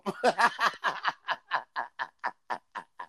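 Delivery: chuckling that runs on and falls away; real long laugh. Real, not acted. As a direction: laugh heartily at your own joke. Cracking up at own joke.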